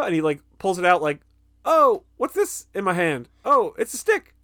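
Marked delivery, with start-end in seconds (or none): 1.6-4.3 high mock-innocent discovery; feigned surprise. [name] shows Don the stick he was hiding, mock surprise. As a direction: act badly surprised by what you are holding.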